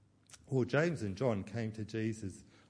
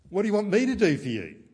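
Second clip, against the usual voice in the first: a loud, high, fast exasperated question; exasperated retort; 9 semitones higher, 13 dB louder, faster than usual. Retold or imitated. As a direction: snap it back as if fed up. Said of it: "He doesn't say".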